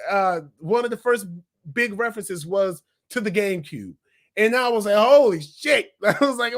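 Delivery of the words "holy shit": louder, higher burst of amazement; excited exclamation. Retold or imitated. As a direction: blurt it out in awe. Reacting to game reference.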